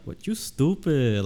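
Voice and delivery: a hint of a sassy Puerto Rican woman; Puerto Rican woman accent. S0 trying a Mexican accent, landing on a Puerto Rican woman. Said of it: "almost Puerto Rican woman".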